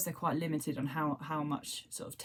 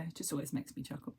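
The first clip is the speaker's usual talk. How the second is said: ordinary delivery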